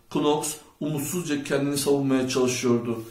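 plain reading voice

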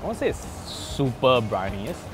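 normal talking voice

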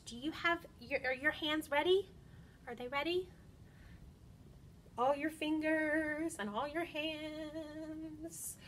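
ordinary delivery

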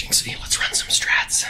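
breathy whispered line; whispering. Shown as a demonstration. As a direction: whisper it close to the mic.

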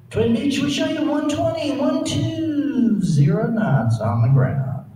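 slow, smooth radio controller drawl; cool controller voice. Retold or imitated. Controller reading speed to Twin Beach, smooth and slow. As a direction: treat the pilot like a shuttle astronaut. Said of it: "talk to him like he's a shuttle astronaut".

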